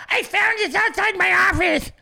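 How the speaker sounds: high, prim fake-innocent voice; mock Haley. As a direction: play the staged innocent finder. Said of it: "staging it now".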